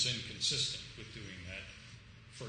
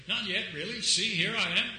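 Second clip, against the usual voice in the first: high, loud, jaunty mock protest; cheeky comic reply; 8 semitones higher, 8 dB louder than usual. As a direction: pop up cheerfully, still alive. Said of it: "Cleese looks as though to say".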